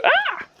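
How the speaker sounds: a sudden, very high womanly shriek. Shown as a demonstration. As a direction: yelp like someone just spotted and shot you. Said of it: "you go".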